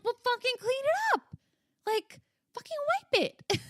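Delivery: very high, strained mock outburst; exasperated urging. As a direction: urge frantically over a fresh spill. Acting out panic over spilled drink.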